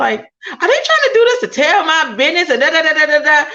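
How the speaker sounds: high-pitched mimicked grumbling; mock indignation. Host mimicking suspicious critics, mock indignation.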